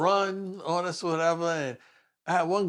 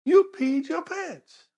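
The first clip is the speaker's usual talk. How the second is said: higher, slow, mocking "You peed your pants"; mock disbelief; 12 semitones higher, much slower than usual. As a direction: rub it in, drawn out. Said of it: "I look at him and go".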